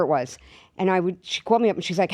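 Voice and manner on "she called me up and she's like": ordinary talk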